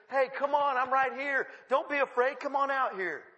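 high, loud, urgent encouragement; acted calling out. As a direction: call them out to you insistently. Voices Jesus calling Peter out.

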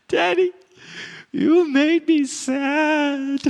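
put-on high, tiny, slow hurt child's voice; toddler voice. Two-year-old daughter telling her father, small hurt voice.

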